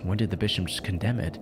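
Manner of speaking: normal talking voice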